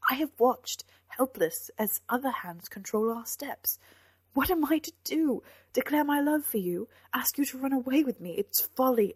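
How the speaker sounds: high, loud, exasperated anguish; impassioned outburst. A narrator's reading. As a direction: throw the questions out in frustration. Knight's outburst in lovers' quarrel.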